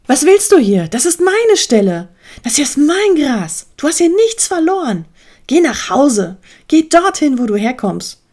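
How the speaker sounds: high-pitched, indignant telling-off; aggressive. A narrator's reading. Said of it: "Adi reagierte sehr aggressiv".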